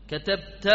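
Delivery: "كتبت" said katabta, ending in an open a; final -ta. Shown as a demonstration. Teacher lists verb endings for class, "كتبت" as katabta. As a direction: close "كتبت" on a clear -ta. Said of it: "وممكن تقول".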